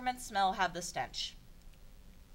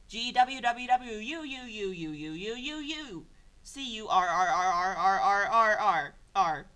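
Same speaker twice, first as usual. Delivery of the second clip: long loud growling gurgle; stomach rumble; 6 dB louder than usual. Acted. Reading the princess's hungry belly, growling rumble noise. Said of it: "a stomach rumbles".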